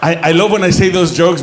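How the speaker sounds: usual speaking voice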